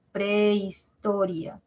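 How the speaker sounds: prehistoria said slowly with the push on to-ri-a; slow teacher demo of prehistoria; stress on toria. Shown as a demonstration. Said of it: "no se pronuncia prehistoria, sino".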